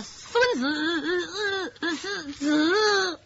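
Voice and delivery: halting, broken stammer with repeated syllables; a sly mock stutter that drags each sound; fake stutter. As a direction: stammer it out on purpose, tripping on every word. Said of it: "突然变得结巴起来".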